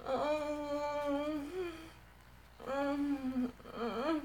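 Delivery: long, drawn-out hum of pleasure; closed-mouth moan. Real, not acted. Intimate moaning, no words.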